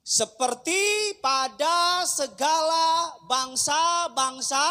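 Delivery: loud, slow, ringing pulpit delivery; preaching at full force. Acted. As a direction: thunder it out to the congregation. (khotbah berapi-api dengan suara lantang)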